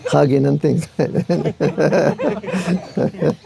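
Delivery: drawn-out words breaking into laughter; laughing. Real, not acted. Telling how the quarrel ended in hugs, laughing. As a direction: let the laugh take over the end. Amused at the quick reconciliation.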